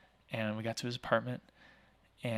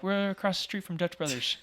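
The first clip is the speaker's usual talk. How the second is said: higher jokey voice; mock answer; 8 semitones higher than usual. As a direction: answer in a playful put-on tone. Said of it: "And we're like".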